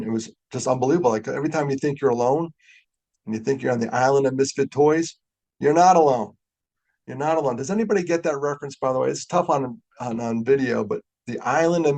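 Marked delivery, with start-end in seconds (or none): 5.6-6.3 louder, punching the word not; emphatic reassurance. Real, not acted. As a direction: land it hard so the room feels it.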